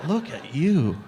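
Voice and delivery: incredulous self-mockery, pitch jumping; mock disbelief. Man to his mirror reflection, mockingly appalled. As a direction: marvel in comic disgust, swooping up at the end. Berates own mirror image.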